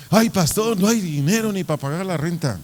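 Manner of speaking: high-pitched fretful complaint; worried. Acted. Church administrator to pastor about money, worried. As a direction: bring the pastor bad news in alarm.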